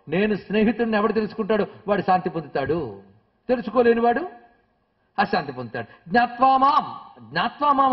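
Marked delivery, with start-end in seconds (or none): 6.1-7.9 జ్ఞాత్వా మాం punched high and loud twice; emphatic repetition. Shown as a demonstration. Teacher stressing the key words of the verse to listeners, emphatic. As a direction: hammer జ్ఞాత్వా మాం home, higher and louder. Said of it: "అన్నాడు, అంతేగాని".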